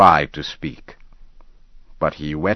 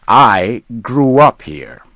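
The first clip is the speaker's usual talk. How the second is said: louder and higher, insisting; heated retort; 5 semitones higher, 7 dB louder than usual. Acted. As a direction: bristle back at him. Hunter answers back.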